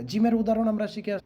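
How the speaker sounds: ordinary delivery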